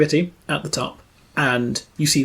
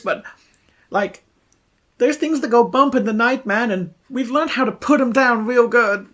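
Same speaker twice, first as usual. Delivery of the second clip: slightly raised slacker voice; calmed young dude; 7 semitones higher than usual. Acted. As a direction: brag earnestly about your crew, dude-style. Plays calmed young crossbow man.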